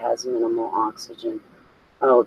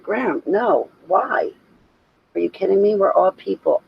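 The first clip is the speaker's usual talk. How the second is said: higher-pitched, lightly replayed disbelief; retold exasperated protest; 6 semitones higher than usual. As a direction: plead with grandma as if she's joking. Protesting grandma's prejudice.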